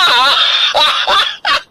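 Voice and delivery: high, shouted belly laugh of joy; triumphant laugh. Acted. Father rejoicing loudly.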